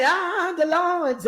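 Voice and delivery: high, drawn-out preacher whoop; stretched, high-pitched mock pulpit cry; preaching. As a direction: sing it out like preaching mid-sermon. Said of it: "I was preaching".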